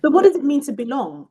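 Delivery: loud emphatic challenge; emphatic question. Real, not acted. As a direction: throw the question out to the room. Emphatic question opening discussion.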